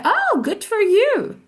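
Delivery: high, slow, delighted congratulation; happy for you. Shown as a demonstration. Congratulating someone, really happy for you. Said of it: "I'm really happy for you".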